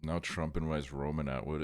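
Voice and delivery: normal talking voice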